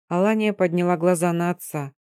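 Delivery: ordinary delivery, nothing marked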